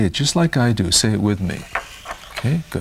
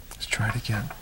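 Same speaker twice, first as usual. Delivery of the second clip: whisper; 2 semitones higher, 11 dB quieter than usual. Real, not acted. Therapist hushes during counting game.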